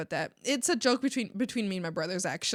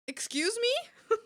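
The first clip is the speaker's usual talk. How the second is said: much higher, slow, stretched "Excuse me?"; outraged disbelief; 13 semitones higher, much slower than usual. Real, not acted. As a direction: drag it out in mock outrage, way up high. Repeats it more outraged.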